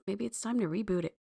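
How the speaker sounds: normal talking voice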